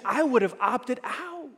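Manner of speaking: ordinary talk, nothing marked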